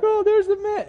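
put-on very high, excited kid voice. Pastor voicing his kids greeting Jim, squealing high. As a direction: squeal it like a thrilled child. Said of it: "And they're all like".

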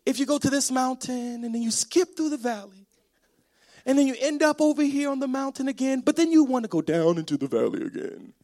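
imitating a wife's leisurely, meandering telling; rambling wife. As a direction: stretch the words out, wandering along. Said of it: "She's like".

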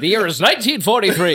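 put-on old-timey announcer. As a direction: rattle it off like a 1940s newsreel. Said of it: "old-timey baseball announcer".

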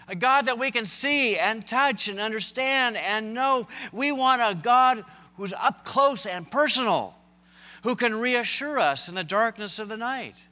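high, forceful pulpit delivery; impassioned preaching. Real, not acted. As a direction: preach it out with rising fervour. Preaches on the story.

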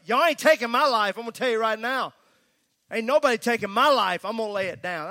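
loud, defiant mock Jesus, chest out; the wrong, combative way to voice this; defiant shout. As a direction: bellow it like a challenge to a fight. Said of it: "That's not how he was saying it".

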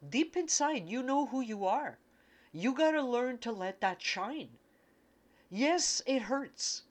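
high, emotional, urgent message; impassioned channeling. Real, not acted. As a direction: pour it out as if overcome by the feeling. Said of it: "I'm fucking channeling again".

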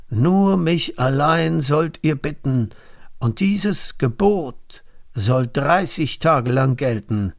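high, ringing town-crier announcement; herald's proclamation. A narrator's reading. Messengers announce the king's law everywhere, proclaiming. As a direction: proclaim it to the whole land. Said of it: "ließ überall ausrufen".

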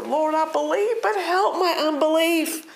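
high-pitched acted plea; pleading. The man in Mark asking the Lord for help, pleading.

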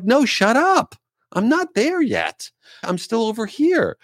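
high-pitched, frantic no-spoilers plea; exasperated protest. Retold or imitated. Complaining to a friend who's ahead in the show, high exasperated protest. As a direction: squeal it like someone about to be spoiled.

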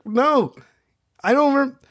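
ordinary talk

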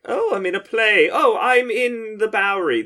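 high, loud gushing audience member; mock wonder. Imitating what Brecht never wanted audiences saying, mock wonder. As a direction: gush high and loud like an enchanted theatregoer. Mocking an immersed theatregoer.

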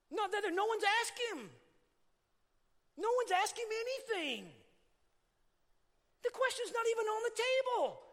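high, loud, ringing climax; preaching at full force. Real, not acted. Preacher to the church, loud and high. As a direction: lift the voice and proclaim.

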